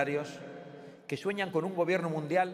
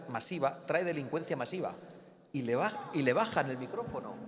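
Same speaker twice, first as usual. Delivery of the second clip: ordinary delivery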